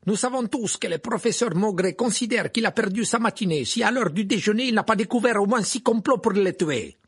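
high, loud mocking jibe. A narrator's reading. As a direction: sneer it for the whole room. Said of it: "dit Karkaroff d'une voix forte". (Karkaroff, voix forte et moqueuse)

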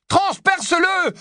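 high urgent "transperce-le" yelled; slowed frantic yell of transperce-le; shouted command. Acted. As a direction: order him to strike. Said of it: "hurla Harry".

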